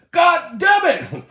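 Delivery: slow, high shouted curse; exasperated outburst. Real, not acted. Comedian exasperated at the star fainting naked, shouted curse. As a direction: shout it, each word drawn out. Exclaims at absent celebrity's antics.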